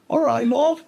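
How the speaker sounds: a friendly Liverpool till lady greeting you; imitating the cashier. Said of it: "la cajera me decía". (imitando a la cajera scouser, mucho más agudo)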